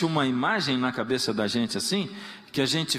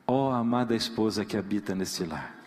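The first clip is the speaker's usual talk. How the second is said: mock formal prayer; 8 semitones lower than usual. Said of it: "olharia pra ela e dizia assim".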